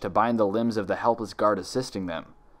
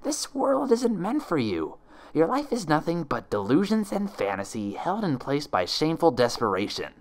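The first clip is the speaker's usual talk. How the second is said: sneering impostor; 6 semitones higher than usual. Acted. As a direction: savour every word of the taunt. Impostor lecturing Twilight.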